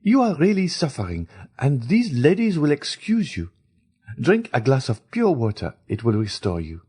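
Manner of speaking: a hint of a caring stepmother; Madame de Villefort speaking. A narrator's reading. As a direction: stepmother to Valentine, lightly voiced concern.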